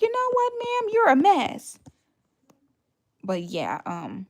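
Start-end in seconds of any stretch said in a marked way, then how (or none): 0.0-1.8 much higher, sassy clapback at the landlady; imitating her own sassy reply to the landlady; sassy retort. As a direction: tell her off with attitude. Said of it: "I'm like".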